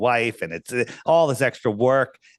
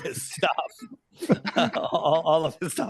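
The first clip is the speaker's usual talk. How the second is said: laughing through the words, slow; 6 semitones higher, much slower than usual. Real, not acted. Recalling hand sanitizer everywhere at the office, laughing. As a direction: giggle as you list it.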